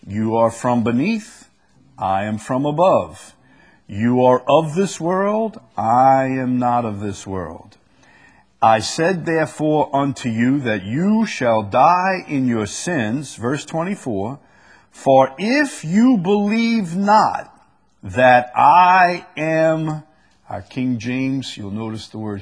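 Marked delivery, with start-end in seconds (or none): 0.1-13.3 loud, deliberate Bible reading; scripture reading. Acted. Reads scripture aloud. 14.9-20.0 very slow, high, loud proclamation; emphatic scripture reading. Acted. Reads scripture aloud.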